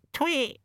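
high mocking little tweet. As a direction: chirp it like a songbird. Raven mimics small birds.

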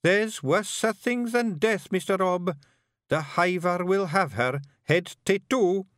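put-on high boyish Scots, thinking hard; young Scottish pictsie bard. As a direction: ponder it like a nervous young bard. Billy warns.

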